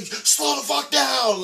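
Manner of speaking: high, slow retold shout; drawn-out holler. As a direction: yell it after a speeding car. Retells yelling at speeding driver.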